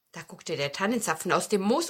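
ordinary delivery, nothing marked

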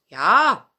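loud hearty yes; kindly old dog. Acted. Old dog agrees, hearty yes. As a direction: answer like a warm old dog.

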